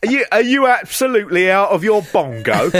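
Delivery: are you mad, mate; hammed-up outraged local; mock incredulous Brit. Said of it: "we'll just go".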